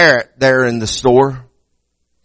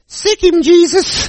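high squawky parrot; 14 semitones higher, slower than usual. Acted. Parrot warning the robber in a high squawk. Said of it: "And the bird says".

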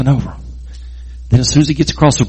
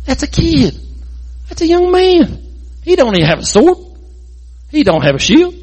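a mock-indignant giant, pitched higher; retold angry giant; 9 semitones higher than usual. Goliath, mocking the shepherd boy, higher mock scoff. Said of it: "he starts getting mad".

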